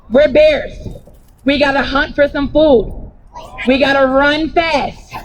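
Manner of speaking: normal talking voice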